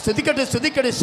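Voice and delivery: high, loud revival shouting; full-force preaching. Real, not acted. Preacher urging congregation to praise aloud, shouted at full force. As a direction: rouse the crowd with ringing, high-pitched cries.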